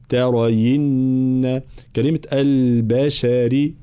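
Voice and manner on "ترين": ترين drawn out very slowly with a clear i on the ya; slow careful ترين; kasra on ya. Shown as a demonstration. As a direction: stretch ترين, showing the kasra. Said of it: "ترين بكسر الياء".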